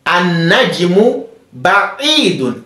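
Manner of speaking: "an-najmu ba'idun" slow and drawn out, each syllable clear; slow model reading. Shown as a demonstration. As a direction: read it slowly for the class to repeat. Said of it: "let's focus on pronunciation of these words".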